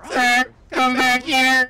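hammed-up space invader hunting a fleeing human; mock alien yelling come back here; alien chaser. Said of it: "sound like we're from another planet".

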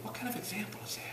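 fast, hushed whisper; whispered question. Real, not acted. Preacher asks his congregation in a sudden hushed whisper. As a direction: drop to a whisper to let it sink in. Rhetorical question after church fighting.

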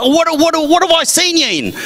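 high mock Aussie, tripping into the question; broad Australian accent. Aussie quizzing actor on his work, high mock Australian accent. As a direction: imitate an eager Australian, pitched high. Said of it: "compared to their accent".

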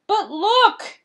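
high, loud outcry; dismayed cry. A narrator's reading. Father crying out on spotting his broken glasses. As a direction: cry out in sudden alarm. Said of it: "he cried".

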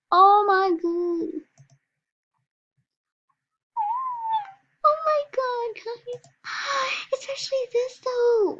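very high squealing gush; squealing excitement. Real, not acted. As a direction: squeal with delight. Squealing over cute item.

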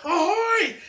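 very high, loud hip hip hooray; a shouted pirate salute cheer. Acted.

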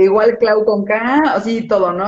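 ordinary talk

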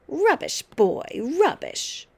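put-on dismissive old grandmother, drawn out; scornful scolding. A narrator's reading. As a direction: scold a small boy with slow scorn.

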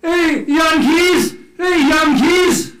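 put-on high, loud holler in a Dutch accent; slow taunting call, mock Dutch accent. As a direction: shout it across with Dutch vowels. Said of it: "they said it in their Dutch accent".

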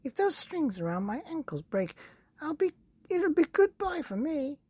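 put-on very high, anxious old woman's voice; Mrs Twit fretting. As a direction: panic rising about the ankle strings.

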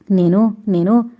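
a cowering, halting stammer; frightened stammer. A narrator's reading. Sister cornered by her angry brother, frightened stammer. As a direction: shrink back as you falter. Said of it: "అంటూ భయంగా ఒదిగి".

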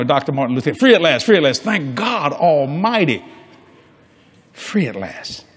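usual speaking voice

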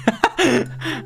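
a high burst of real laughter; high giggle. Real, not acted. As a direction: giggle at the absurdity. Laughing at the flight attendants.

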